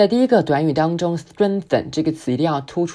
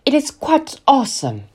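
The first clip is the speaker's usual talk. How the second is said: much higher and louder, thrilled; excited, pitched up; 10 semitones higher, 5 dB louder than usual. Shown as a demonstration. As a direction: raise the key and sound thrilled. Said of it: "把key往上升". (提高音调，兴奋地说)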